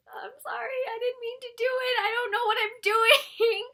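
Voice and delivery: high, loud fake sob, slowing at the end; hammed-up tearful sorry; mock crying apology. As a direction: whimper it out like a scolded kid. Tearful apology after wand mishap.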